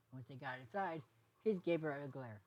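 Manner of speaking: ordinary delivery, nothing marked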